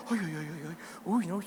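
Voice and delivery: low, soft mock tutting; desaprobación fingida. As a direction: act out hushed dismay. Mimicking disapproval of tattooed newcomers. (desaprobación fingida en voz baja)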